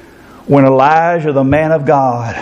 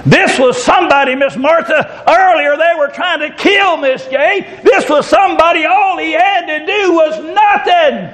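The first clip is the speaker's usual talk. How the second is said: raised, fervent preacher's voice, slowing on key words; preaching at full force; 11 semitones higher than usual. Acted. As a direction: preach it out to the congregation. Preacher building to climax.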